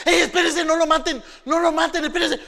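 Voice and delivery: high, loud pleading; urgent plea to stop. Acted. Rubén shouting at brothers to spare Joseph. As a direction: hold them back from killing.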